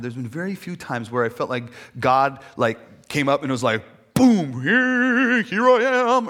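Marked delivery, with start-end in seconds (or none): 4.1-6.3 high, very loud mock proclamation; hammed-up divine arrival shout; booming grand entrance. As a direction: burst in with a huge announcement. Acts out God's dramatic arrival.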